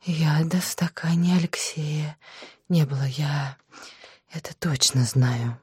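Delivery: breathless, frightened whisper; shaky whisper. Acted. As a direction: gasp it out in a whisper. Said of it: "Маша судорожно перевела дыхание".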